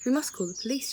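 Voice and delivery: an aunt crying out to call the police; alarmed cry. A narrator's reading. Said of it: "she cried".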